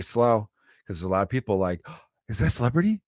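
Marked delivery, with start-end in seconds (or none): 1.9-3.0 high gasping celebrity spotter; gasp, then gawk in a raised voice; mock star-struck. Mimics star-struck airport crowd.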